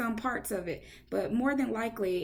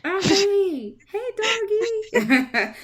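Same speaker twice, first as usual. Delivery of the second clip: talking to a dog; 10 semitones higher, 8 dB louder, much slower than usual. Real, not acted. Host greets her guest's barking dog in high doggy talk. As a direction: coo over the doggy with a laugh in it.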